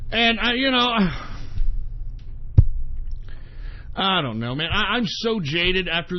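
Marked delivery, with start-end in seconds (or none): 0.9-3.9 long, heavy exhale; weary sigh. Real, not acted. Broadcaster losing the thread, long weary sigh. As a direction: sigh as if giving up. Too fed up to go on.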